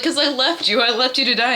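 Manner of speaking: mock-tearful guilt; tearful confession, retold. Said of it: "he's, like, crying".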